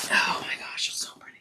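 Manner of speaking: breathless whispered gush; soft whisper of delight; whispered awe. Real, not acted. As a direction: hush it like you can't believe it. Whispered gush over bag.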